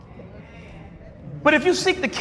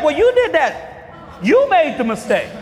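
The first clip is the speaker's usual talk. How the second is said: ordinary delivery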